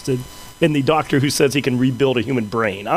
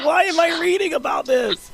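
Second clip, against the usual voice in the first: exasperated shout; 13 semitones higher than usual. Real, not acted. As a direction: yell it in disbelief. Exasperated at weird Google result.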